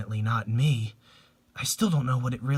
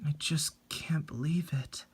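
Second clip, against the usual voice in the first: barely voiced, shaken; stunned whisper; 3 semitones higher, 7 dB quieter than usual. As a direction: whisper it in disbelief. Grieving friend at the scene.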